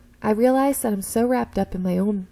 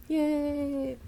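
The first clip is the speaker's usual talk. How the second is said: higher, drawn-out little yay; mock cheer; 6 semitones higher, 4 dB quieter than usual. Class encouraging a classmate, mock cheer. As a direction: cheer along in a put-on class voice.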